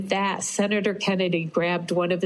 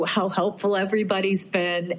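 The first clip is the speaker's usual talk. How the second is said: normal talking voice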